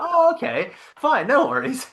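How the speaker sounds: very high, loud imitation of easy acceptance; oblivious mum. Mum told it's air freshener, high and unsuspecting. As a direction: put on a mum happily fooled. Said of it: "my mum had no idea".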